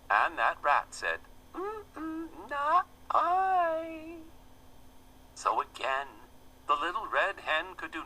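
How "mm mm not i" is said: very high squeaky rat; snippy rat. A narrator's reading. The rat turning its back on the hen, high squeak. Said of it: "with snippy glee".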